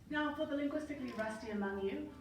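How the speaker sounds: usual speaking voice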